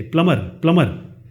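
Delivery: normal talking voice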